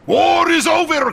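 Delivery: high, loud cry of victory; overjoyed victory shout; jubilant shout. Acted. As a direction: shout the news out in triumph. Premier announces Allied surrender.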